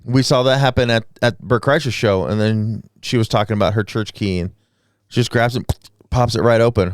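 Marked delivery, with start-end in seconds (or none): none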